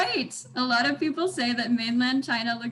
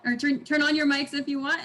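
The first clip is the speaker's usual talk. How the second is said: usual speaking voice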